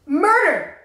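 ordinary delivery

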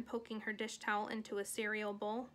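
plain reading voice

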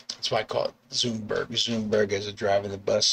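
ordinary talk, nothing marked

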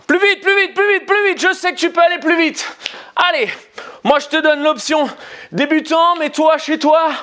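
pumped-up coach urging the pace; urgent coaching. Real, not acted. Coach urging viewers to go faster.

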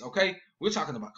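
ordinary talk

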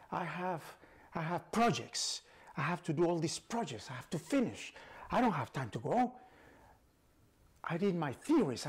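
high loud desperate pleas; panicked pleading. Acted. Einstein pleads not to die.

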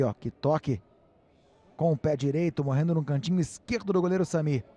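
ordinary talk, nothing marked

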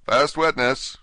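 calling out. Acted. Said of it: "called out".

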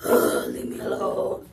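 very low, groaning bear; fed-up polar bear. Acted. Polar bear brushing off the goose, fed-up low groan. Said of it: "And he's like".

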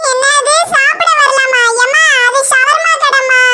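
put-on drawn-out childish correction; child's voice. The child corrects the mother about the shawarma shop, slowly and high.

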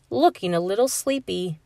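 usual speaking voice

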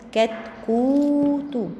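"كتكوت" slowly, with a very long oo; long vowel demo. Shown as a demonstration. Teacher showing the long waw, "كتكوت" with drawn-out oo. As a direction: hold the "كو" in "كتكوت" right out. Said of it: "كاف ضمة وواو مد".

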